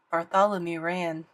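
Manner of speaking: plain reading voice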